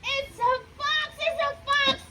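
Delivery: high panicky cry of a fox; a hammed-up frightened hen; alarmed hen. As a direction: squawk it out in alarm.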